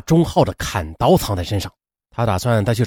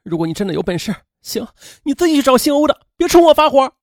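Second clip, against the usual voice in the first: tearful outburst; 10 semitones higher than usual. A narrator's reading. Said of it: "便哭泣着说".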